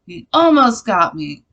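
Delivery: loud, high exasperated outburst; retold anger. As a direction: re-enact being really mad at Satan. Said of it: "I got really mad at Satan".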